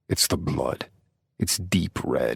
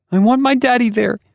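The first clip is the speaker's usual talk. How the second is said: put-on high, loud child voice; a frightened little girl blurting it out; 7 dB louder than usual. A narrator's reading. Daughter tells her father, high scared child voice.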